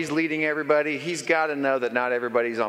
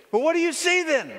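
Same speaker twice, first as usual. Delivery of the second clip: high, barking boss voice; impatient commander calling up to the wall; boss questioning; 3 dB louder than usual. Acted. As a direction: play the demanding boss up high.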